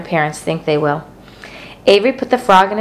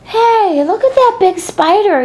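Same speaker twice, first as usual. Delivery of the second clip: excited; 14 semitones higher than usual. A narrator's reading. Avery excited at spider.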